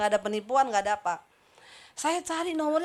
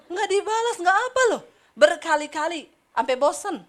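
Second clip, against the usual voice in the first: loud exasperation; 5 semitones higher, 7 dB louder than usual. Real, not acted. Exasperated, laughing.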